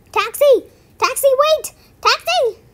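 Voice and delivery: high, loud hail after a taxi; yelling down the street for a cab; calling out. Acted. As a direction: shout to stop the taxi.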